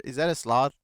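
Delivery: ordinary delivery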